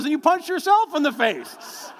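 slow, high laughing reply; laughing retort. Retold or imitated. Son explaining the punch to his father, laughing. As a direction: tease him back, laughing.

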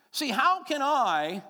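loud, high, slow appeal; rising appeal. Acted. Pastor challenges the congregation, loud and slow. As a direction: cry it out to the room. Sermon climax question.

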